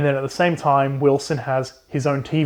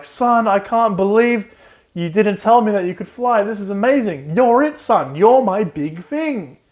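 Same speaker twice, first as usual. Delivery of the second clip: overjoyed dad, stretching it out; proud dad; 7 semitones higher than usual. Retold or imitated. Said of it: "he's like".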